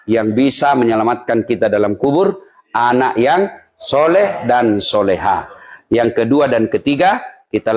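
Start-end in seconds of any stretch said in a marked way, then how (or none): 2.7-5.5 drawn out slowly; slow emphasis. Real, not acted. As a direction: weigh each word. Stressing the key point. (diucapkan perlahan dan mantap)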